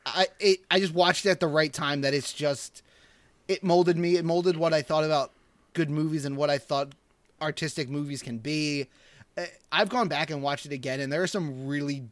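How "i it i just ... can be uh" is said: usual speaking voice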